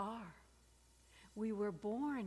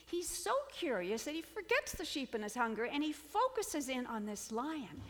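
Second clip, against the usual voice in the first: normal talking voice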